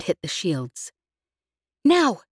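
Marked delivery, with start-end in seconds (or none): 1.8-2.2 sharp, loud, high snap of a command; snapped. A narrator's reading. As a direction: bark it out fast and hard. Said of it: "she snapped".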